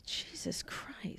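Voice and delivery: breathless exasperated whisper; slow, almost silent muttered groan. Real, not acted. Exasperated aside to co-host.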